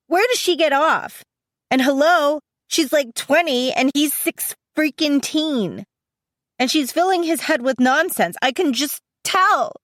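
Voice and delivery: high-pitched, worked-up venting; indignant rant. A narrator's reading. Sister fuming over brother's girlfriend, indignant rant. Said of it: "I finally run out of breath".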